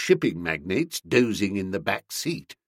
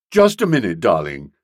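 placating interruption; 4 semitones lower, 6 dB louder than usual. A narrator's reading. Boko heads off furious Nobby.

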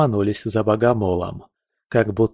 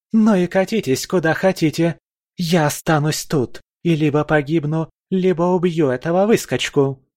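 higher, bitter enraged voice; defiant rage at the deserters; furious defiance; 7 semitones higher than usual. A narrator's reading. Valkyrie rages at deserters. (яростный вызов)

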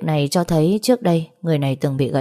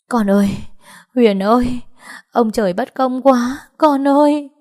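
high, loud wailing lament rising to the end; wailing cry; 8 semitones higher, 4 dB louder than usual. A narrator's reading. Parents wailing to daughter Huyền: loud grieving cry. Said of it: "bố mẹ đã gào khóc".

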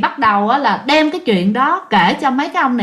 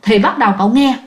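ordinary delivery, nothing marked

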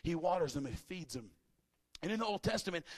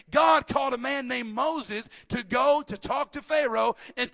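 loud, higher, driving sermon voice; full-throated pulpit delivery; preaching at full force; 6 semitones higher, 8 dB louder than usual. Acted. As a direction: preach it to the back row.

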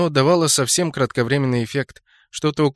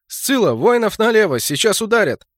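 raised-pitch battle order; urgent command; 8 semitones higher, 4 dB louder than usual. A narrator's reading. Orc fighter passing orders as the strike comes, urgent command. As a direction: call out a warning order in combat. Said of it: "Орчиха быстро повторила команду".

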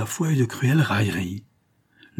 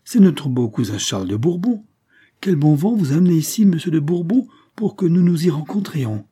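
higher, lifted greeting from a king; animated, high-pitched exclamation; called-out greeting; 7 semitones higher, 5 dB louder than usual. A narrator's reading. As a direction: hail a cousin across the hall. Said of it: "s'écria le roi du plus loin".

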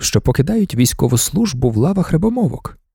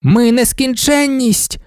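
high, drawn-out fervent cry; crowd's call; 9 semitones higher, slower than usual. A narrator's reading. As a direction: call it out as a whole crowd of worshippers. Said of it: "гукнули вони".

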